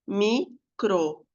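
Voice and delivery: "Microfone" said slowly, syllable by syllable: mi-cro-fo-ne; split into syllables. Shown as a demonstration. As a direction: stretch "Microfone" out, tapping each syllable. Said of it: "primeiro eu posso segmentar oralmente".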